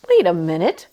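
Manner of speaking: ordinary delivery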